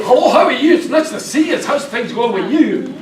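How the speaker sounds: hammed-up strong Scottish accent. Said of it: "very strong accent".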